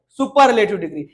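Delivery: usual speaking voice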